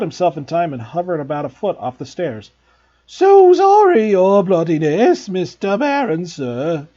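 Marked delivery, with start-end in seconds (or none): 3.1-6.9 high, loud, slow oily grovelling; greasy poltergeist. Acted. Peeves grovelling to the Bloody Baron, greasy and high. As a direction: fawn over the Baron like a toady. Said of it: "he said greasily".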